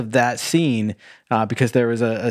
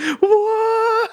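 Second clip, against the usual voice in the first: stunned what; 4 dB louder than usual. Retold or imitated. Bewildered woman quoted mid-scene.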